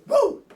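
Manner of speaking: high, loud mock cheer; sarcastic whoop. Mocking the NFL's tough schedule with a loud fake whoop.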